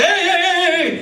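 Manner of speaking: high, comic warning cries; mock a panicked backseat driver; alarmed passenger. Passenger startling the driver, alarmed high cries.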